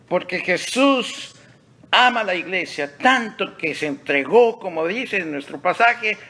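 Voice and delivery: high, loud, impassioned sermon delivery; full-force preaching. Real, not acted. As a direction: proclaim it loudly to the congregation.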